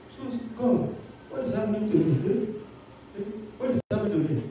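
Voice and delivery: hushed, confused cry to God. Acted. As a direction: ask God quietly what is wrong. Pastor bewildered, pleading.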